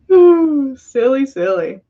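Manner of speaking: usual speaking voice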